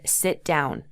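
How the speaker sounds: firm and direct. Shown as a demonstration. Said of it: "It's more firm and direct".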